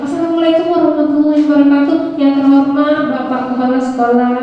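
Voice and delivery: hurried speech opening, a touch fast; rushed nervous opening. Shown as a demonstration. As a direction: rattle off the greeting to get it over with. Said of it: "cepat-cepatlah. Biar cepat selesai". (membaca salam terburu-buru karena grogi)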